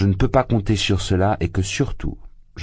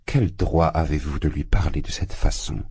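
ordinary delivery, nothing marked

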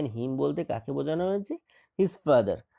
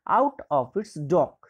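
slow reading aloud; 3 dB louder, much slower than usual. Shown as a demonstration. Teacher reads English text slowly.

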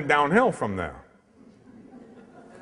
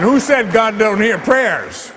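higher, louder and slow, savouring it; triumphant punchline; 7 semitones higher, 6 dB louder, slower than usual. Real, not acted. Priest delivering the punchline to the congregation, triumphant. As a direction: land the joke to the congregation. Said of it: "rhetorical punchline".

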